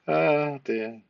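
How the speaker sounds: ordinary delivery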